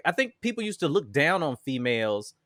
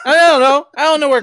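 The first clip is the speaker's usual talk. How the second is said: loud high exclamation; 11 semitones higher, 11 dB louder than usual. Real, not acted. Mock-defensive shout.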